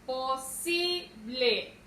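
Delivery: po... SÍ... ble, falling at the end of "Posible"; stressed middle syllable. Shown as a demonstration. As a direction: draw out the SI in "Posible". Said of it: "tiene su sílaba tónica en la".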